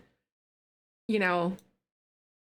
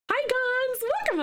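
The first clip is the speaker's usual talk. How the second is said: high greeting. Real, not acted.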